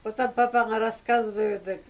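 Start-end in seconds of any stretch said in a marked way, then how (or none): none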